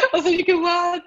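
high, cracking stunned cry of disbelief; laughing disbelief, voice jumping up high; stunned what. Retold or imitated. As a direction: squeak out a shocked what. Her stunned reaction to rejection.

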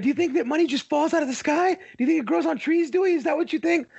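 high, fast scolding dad impression; dad catchphrase voice. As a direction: mimic a nagging TV dad. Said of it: "throw in some of his old catchphrases".

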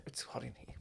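breathy whispered aside. Real, not acted. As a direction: whisper it under your breath. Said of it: "whispered aside".

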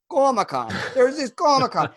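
put-on high-pitched Boston accent; cartoon-thick Boston accent; imitated Boston cabbie. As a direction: mimic a chatty Boston cab driver. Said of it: "he was telling us about Comic-Con".

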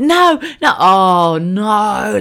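high alarmed no no no; a mother catching her child, alarmed; panicked no. Retold or imitated. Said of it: "I was like".

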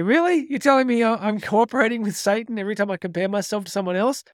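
shocked audience member, voice jumping up; incredulous people. Retold or imitated. A speaker's listeners, outraged at the Satan quote, in high disbelief. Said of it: "They go".

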